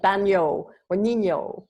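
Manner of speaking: "baño or niño" with a ny sound; Spanish-style "baño or niño". Shown as a demonstration. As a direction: model the ny glide in "baño or niño". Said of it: "if you're speaking Spanish".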